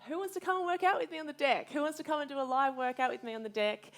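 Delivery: high, loud, upbeat invitation; rally the followers like a fitness instructor; calling her Instagram followers to a live workout, bright and loud; excited call-out. Retold or imitated. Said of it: "I said to my Instagram followers".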